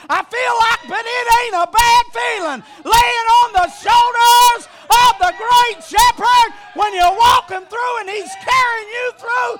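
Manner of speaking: loud, high shouted preaching with held-out line endings; full-force preaching. Real, not acted. Preacher thrilled to be carried home, full-force shouted preaching. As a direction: preach it in driving phrases, stretching the last word.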